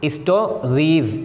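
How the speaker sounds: स्टोरीज slowly, ending on a buzzing z; slow teacher demo of स्टोरीज; z sound in stories. Shown as a demonstration. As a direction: stretch out स्टोरीज and land on the z. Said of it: "हम जा की आवाज़ पढ़ेंगे".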